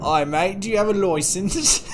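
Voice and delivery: high-pitched, hammed-up Cockney; Cockney accent. Streamer mock-demanding a license, hammed-up Cockney accent. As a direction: ask it as a squeaky Cockney caricature. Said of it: "older Cockney".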